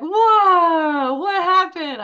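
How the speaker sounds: high, loud startled cry; astonished. Retold or imitated. Finding the arm weights on the bed, a high startled whoa. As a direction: yelp it out in disbelief. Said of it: "And so I was like".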